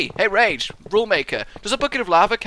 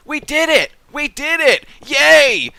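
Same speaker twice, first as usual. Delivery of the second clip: high, loud triumphant cheer; excited cheer; 9 semitones higher, 8 dB louder than usual. Acted. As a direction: burst out celebrating. Celebrating after whisper.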